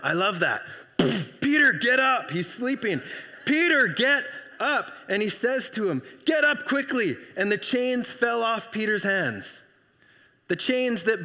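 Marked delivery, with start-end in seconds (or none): 1.4-2.3 urgent wake-up call. Retold or imitated. Angel waking sleeping Peter. 3.4-4.9 urgent wake-up call. Retold or imitated. Angel waking sleeping Peter. 6.2-7.2 urgent command. Retold or imitated. Angel urging Peter.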